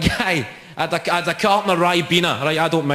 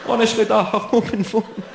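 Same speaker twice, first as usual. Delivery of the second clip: put-on higher, whiny teen denial; a kid lying to his dad, a touch raised; pleading son; 6 semitones higher than usual.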